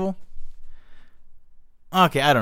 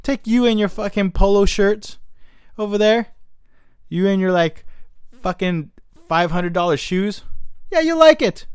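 higher, sugary sarcasm; mock friendly; 5 semitones higher than usual. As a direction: sell them a dump with fake warmth. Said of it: "I was gonna be that ruthless".

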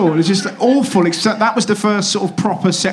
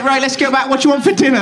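higher, brisk mum voice; lightly put-on higher voice of a mother; 6 semitones higher, faster than usual. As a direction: chivvy the child along as mum.

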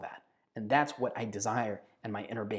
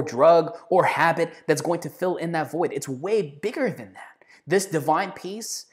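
impassioned preaching; 3 semitones higher, 4 dB louder than usual. Acted. Preaching with rising force.

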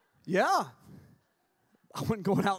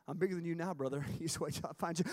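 low, quiet menacing uncle voice; 5 semitones lower, 5 dB quieter, faster than usual. Retold or imitated. Telling campers a scary story, low menacing uncle. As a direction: growl it softly like a scary uncle.

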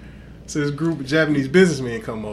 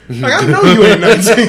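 loud, laughing disbelief; laughing callout; 5 semitones higher, 12 dB louder than usual. Retold or imitated. Telling a girl he knows her age is fake, loud laughing. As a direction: laugh her lie right back at her.

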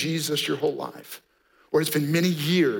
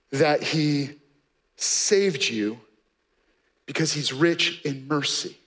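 ordinary talk

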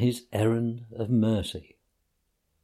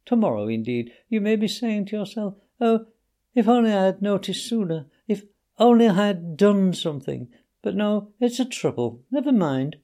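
lightly voiced dying Toad, higher; a hint of a faked deathbed plea; feeble invalid Toad; 7 semitones higher than usual. A narrator's reading. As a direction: Toad begging Rat to fetch the doctor.

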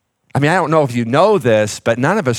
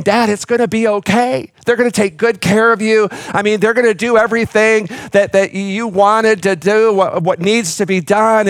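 high, earnest reassurance, pressing gently; reassuring, persuading; 8 semitones higher than usual. Retold or imitated. Son urging his father to go to West Campus, high earnest reassurance. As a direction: coax him, voice lifted and pleading. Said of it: "I'm trying to sell this to him".

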